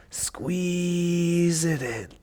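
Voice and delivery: slow soft drawn-out cue. Shown as a demonstration. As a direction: stretch the word out softly.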